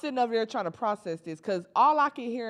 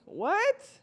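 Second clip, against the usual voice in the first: outraged disbelief; 10 semitones higher than usual. Real, not acted.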